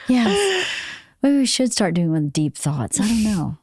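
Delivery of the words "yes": high airy sigh then yes; breathy high sigh. Pretend. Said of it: "Like your voice?".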